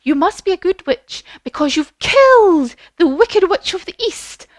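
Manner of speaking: high, dramatic good-witch pronouncement; high storybook lady, big on the key word; Glinda. Acted. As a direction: announce it grandly in a high fairy voice. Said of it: "And she says to".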